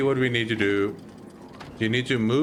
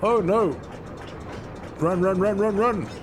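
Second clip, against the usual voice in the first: high panicked urging; being chased; 10 semitones higher than usual. Real, not acted. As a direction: yell run while fleeing danger. Chased by enemy.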